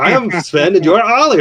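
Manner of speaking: a silly cartoon Swede; Scandinavian accent. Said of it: "I kept thinking of that bit from".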